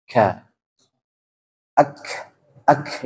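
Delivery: "Ak" with an audible breathy k release; kaf releasing air. Shown as a demonstration. Teacher summing up kaf, k released with breath. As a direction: stop the k, then let air out. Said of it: "dia mengeluarkan udara".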